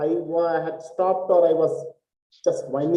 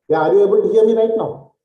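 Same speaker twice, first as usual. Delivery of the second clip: louder, pushing the voice out; 7 semitones higher, 9 dB louder, faster than usual. Real, not acted. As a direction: speak up so everyone hears. Checking students can hear him.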